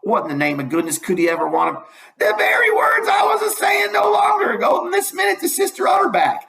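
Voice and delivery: high village gossip voice; Old Miss Damerel. Acted. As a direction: fuss over the rag ladder like a nosy neighbour. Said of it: "says old Miss Damerel".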